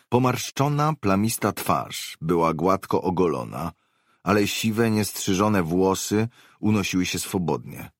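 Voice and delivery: ordinary delivery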